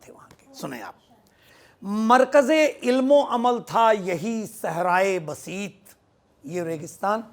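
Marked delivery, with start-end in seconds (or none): 1.8-5.9 slow, raised declamation of Urdu verse; poetry recitation. Acted. Poet opens his naat nazm to a gathering, slow declamation. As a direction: recite it higher and drawn out.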